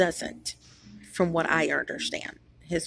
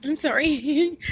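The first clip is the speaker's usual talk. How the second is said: high-pitched, louder apology through laughter; laughing fit; 10 semitones higher, 5 dB louder, slower than usual. Real, not acted. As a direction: break up laughing while saying excuse me. Cracks up mid-sentence.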